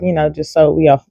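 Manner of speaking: ordinary talk, nothing marked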